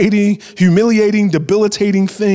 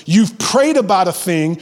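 ordinary talk

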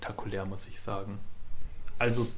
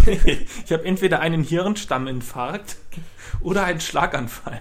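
laughing; 5 semitones higher, 8 dB louder than usual. Pretend. Laughing mock diagnosis.

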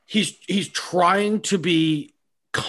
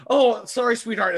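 higher, sugary apology; mock sweet apology; 7 semitones higher, 4 dB louder than usual. Apologizing sweetly to a partner while fuming inside, mock sugary. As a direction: fake sweetness to your partner.

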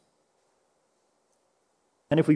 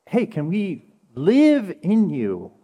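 earnest plea; much slower than usual. Pretend. Deep request, slow and earnest.

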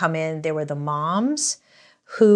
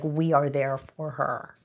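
entitled customer; 3 semitones lower, 3 dB quieter, slower than usual. Retold or imitated. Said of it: "She's like".

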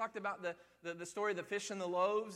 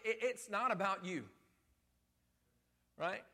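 normal talking voice

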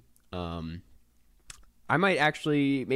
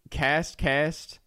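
amateur Australian accent, pitched higher; attempted Aussie accent; 5 semitones higher than usual. Shown as a demonstration. Host testing the podcast's name, attempted Aussie accent. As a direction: try the word in rough Aussie. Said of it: "say it in an Aussie accent".

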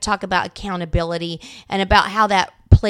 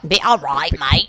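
put-on Australian accent; 7 semitones lower than usual. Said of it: "an Australian accent".

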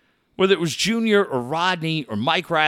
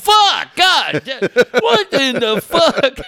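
high, loud imitation of an exploding manager; storytelling outburst, each curse punched high and loud; retold cursing fit; 8 semitones higher, 6 dB louder, slower than usual. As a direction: explode in mock disbelief. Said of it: "And he drops twenty F-bombs".

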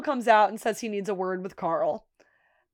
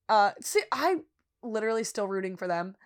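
usual speaking voice

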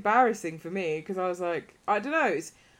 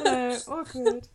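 higher, louder jokey greeting; mock awkward; 6 semitones higher, 4 dB louder than usual. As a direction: play up the social awkwardness. Mimicking her own awkward quiet greeting.

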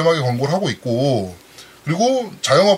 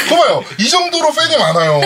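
excited; 10 semitones higher, 5 dB louder than usual. Real, not acted. Gleeful teasing brag.

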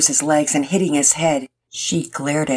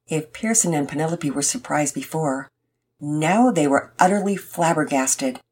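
usual speaking voice

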